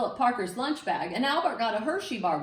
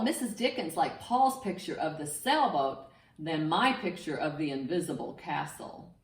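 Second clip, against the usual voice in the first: ordinary delivery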